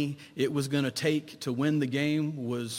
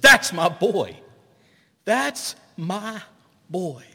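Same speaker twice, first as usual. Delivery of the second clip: loud, defiant pride for a losing son; proud father calling out; 6 semitones higher, 10 dB louder, slower than usual. Retold or imitated. Father of the struck-out batter calling out, loud and proud. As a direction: stand up and claim him loud. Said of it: "a father that stood up and said".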